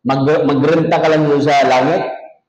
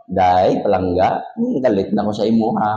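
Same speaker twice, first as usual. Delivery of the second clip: gentle Ilonggo quarrel; 8 semitones lower than usual. Retold or imitated. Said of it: "Pag nag-away siguro sila ... ang ganda siguro".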